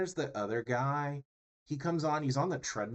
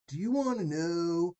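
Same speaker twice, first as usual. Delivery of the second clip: higher, drawn-out ad-guy question; twenty-five-year-old YouTube pitchman; 7 semitones higher, 4 dB louder, slower than usual. Acted. Mocking YouTube ads to an audience, drawn-out pitchman voice. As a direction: stretch it like a mock online hustler. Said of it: "I don't know why I'm talking like this".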